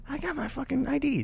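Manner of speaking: high, soft exasperated protest; high, hushed mock exasperation. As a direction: plead like a frustrated customer. Imitating carded person.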